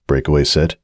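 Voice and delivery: plain reading voice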